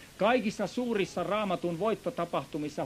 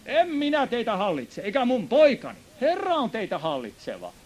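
higher and louder, stressing "En minä teitä hallitse"; emphatic Gideon; 7 semitones higher, 4 dB louder than usual. Retold or imitated. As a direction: proclaim Gideon's refusal with force. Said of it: "Gideon vastasi heille". (painokkaasti julistaen)

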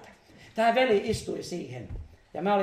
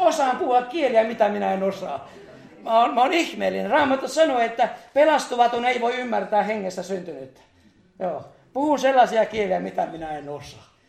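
louder, higher, emphatic testimony; full-force preaching; 4 semitones higher, 6 dB louder than usual. Acted. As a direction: proclaim it to the congregation. (täysin voimin saarnattu todistus, kovempi)

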